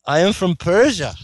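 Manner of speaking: a mock of the liar saying where he's from; imitating the man. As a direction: put on his insistent voice. Imitating the man's claim.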